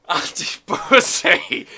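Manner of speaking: slow, breathy laugh through it. Real, not acted.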